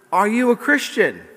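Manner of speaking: high, loud acted question; calling down. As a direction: call the question down to a passerby. Parachutist calls down to the walker.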